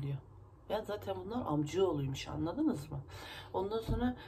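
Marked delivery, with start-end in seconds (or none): none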